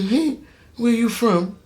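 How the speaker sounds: high, slow put-on voice of a stranger; mock curious stranger voice; curious questioner. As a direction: play the nosy coworker asking. Retold questioner at work.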